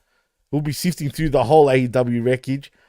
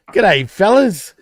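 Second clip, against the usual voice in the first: exaggerated Aussie greeting, louder at the end; Ocker accent; 4 semitones higher, 6 dB louder, much slower than usual. Shown as a demonstration. Co-host demonstrating a show greeting, hammed-up Ocker Aussie. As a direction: ham up the Ocker Australian. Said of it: "that's called the Ocker accent".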